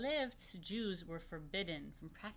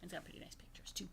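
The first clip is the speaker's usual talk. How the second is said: whisper; 2 semitones lower, 8 dB quieter, faster than usual. Real, not acted. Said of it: "whispered aside about book".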